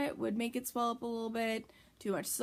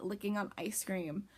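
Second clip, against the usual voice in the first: ordinary delivery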